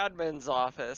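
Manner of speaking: ordinary delivery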